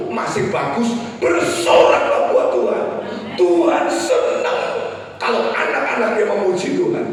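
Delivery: loud, high exhortation to the congregation; rally the congregation at full voice, stretching key words; impassioned preaching. Real, not acted.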